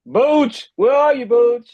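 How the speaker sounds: high, loud comic call; high-pitched mock search call. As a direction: holler playfully for a missing friend. Calls out for absent friend.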